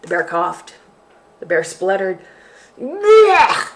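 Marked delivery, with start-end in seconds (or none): 2.8-3.7 loud, high spit-out blech; disgusted blech. Acted. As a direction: howl it in disgust. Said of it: "it howled".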